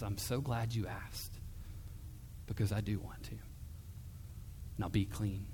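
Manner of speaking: hushed loving answer; tender reassurance. Acted. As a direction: speak it like a kind doctor to a leper.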